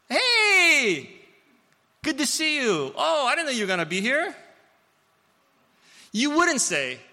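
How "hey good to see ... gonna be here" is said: put-on much higher, louder, bubbly hello to an old friend; casual happy greeting. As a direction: greet a friend you bumped into, high and bright. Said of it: "what do you say?".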